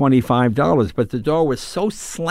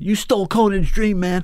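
higher-pitched mock yell; mild, raised-pitch imitation of screaming; retold angry yelling; 6 semitones higher than usual. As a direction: imitate an accusing driver, not at full volume. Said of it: "he starts screaming at me".

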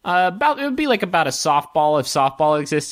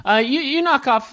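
ordinary delivery

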